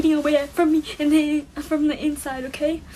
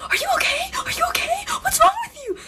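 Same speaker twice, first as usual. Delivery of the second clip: much higher, frantic friend voice; worried friend. Retold or imitated. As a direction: squeal it like a panicked friend running over. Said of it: "She's like".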